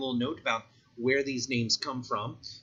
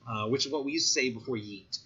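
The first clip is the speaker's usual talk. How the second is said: ordinary talk, nothing marked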